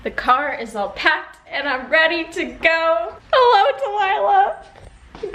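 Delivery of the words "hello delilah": high, slow hammed-up crying hello; mock sob. Greeting her old room with a mock sob. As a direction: wail it like a fake heartbreak.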